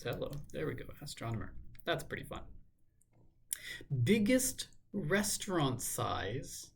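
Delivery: ordinary delivery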